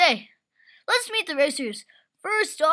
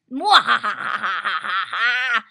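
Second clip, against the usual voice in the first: deep, slow mock evil cackle; evil villain laugh; 9 semitones lower, much slower than usual.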